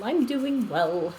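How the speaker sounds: I'm doing well, stretched slowly; slow and drawn out. Real, not acted. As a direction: ease it out slowly, word by word. Answering chat, two takes.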